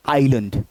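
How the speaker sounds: आइलैंड said "eye-lund", weak second vowel; correct pronunciation. Shown as a demonstration. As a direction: reduce the end of आइलैंड to "lund". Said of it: "सही pronunciation क्या है".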